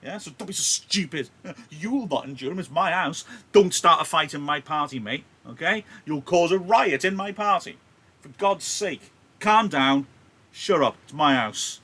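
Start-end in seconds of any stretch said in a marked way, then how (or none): 1.7-11.8 high, loud furious scolding; angry Capulet. Acted. Capulet scolding hot-headed Tybalt at his party, shouting angrily. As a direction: bellow him down, outraged host. Modern paraphrase of Capulet's rebuke.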